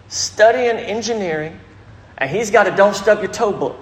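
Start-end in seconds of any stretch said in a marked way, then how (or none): none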